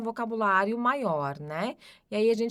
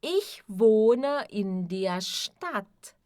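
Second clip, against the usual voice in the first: Ich wohne in der Stadt very slow; slow pronunciation drill; much slower than usual. Shown as a demonstration. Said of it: "melhor vai ficar a pronúncia de vocês". (Ich wohne in der Stadt bem devagar)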